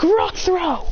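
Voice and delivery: high-pitched called-out move; attack command. Acted. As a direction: call the attack across the field. Trainer shouts attack command.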